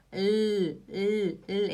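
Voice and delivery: isolated held "L", tongue tip up. Shown as a demonstration. Teacher showing where the tongue goes, clear L. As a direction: sound a clear "L" by itself, repeatedly. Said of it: "ele vai lá em cima".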